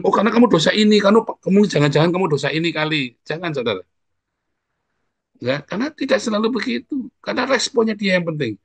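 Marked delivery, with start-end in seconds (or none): 0.0-3.1 higher and louder, pointing the finger at a sufferer; mock the blaming friend, raised up; a hammed-up accusing know-it-all; judgmental accusation.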